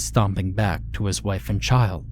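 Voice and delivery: usual speaking voice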